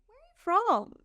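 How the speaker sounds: curious stranger. Retold or imitated. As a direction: pop it up high like a surprised shopkeeper. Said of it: "get away without somebody saying".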